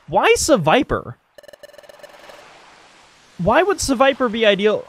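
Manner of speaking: high, incredulous questioning; baffled protest. Real, not acted. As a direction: question the choice in high-pitched disbelief. Protests the choice made in the game.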